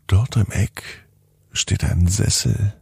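plain reading voice

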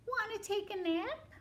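very high, small-voiced question; storybook cat. Cat invites the spider to nap, high little voice. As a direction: kitten voice, sweetly asking. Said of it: "cried the cat".

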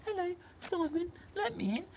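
high, small, soft voice; little high-pitched gadget voice; talking key. Pretend. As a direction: play a tiny key calling to its car. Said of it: "the car can hear you going".

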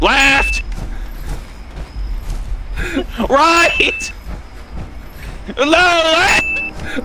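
high urgent yells; shouting directions. Real, not acted. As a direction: yell the directions fast.

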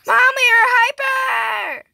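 high, quick, hollered announcement; high-pitched excited yell; excited shout to Mom. Pretend. Calling out the same news louder.

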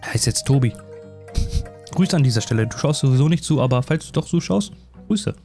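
usual speaking voice

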